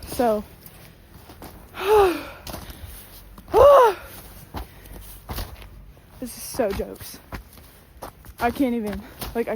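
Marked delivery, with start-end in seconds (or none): 1.1-5.9 loud exhausted sigh. Real, not acted. Exhausted after climbing hill.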